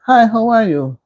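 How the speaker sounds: a high, bright mock greeting from someone at church; chirpy imitation of a stranger saying hello; polite greeting. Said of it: "somebody even say to them".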